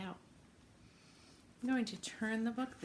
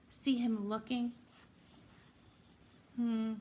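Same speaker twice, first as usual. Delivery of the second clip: ordinary delivery, nothing marked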